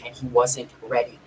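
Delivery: usual speaking voice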